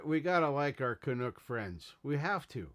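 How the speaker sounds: usual speaking voice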